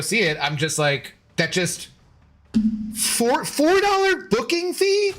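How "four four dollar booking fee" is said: high, disbelieving rise on the fee; stunned it costs that much; incredulous. Real, not acted. Ranting about ticket fee.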